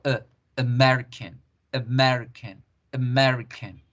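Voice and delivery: "American" as uh-MER-i-kun, mouth barely open; schwa pronunciation. Shown as a demonstration. Teacher shows what she says instead of ah, schwa pronunciation. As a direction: keep both A's of "American" a neutral uh, stress MER. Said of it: "digo, eh".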